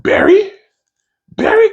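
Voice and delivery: high-pitched squeak of disbelief; incredulous. Real, not acted. Nitpicking fan balks at the show's wrong word, incredulous.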